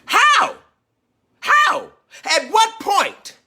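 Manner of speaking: sharp high disbelieving "How?"; incredulous. Real, not acted. To viewers, an incredulous high "How?". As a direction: fire the question up high in disbelief.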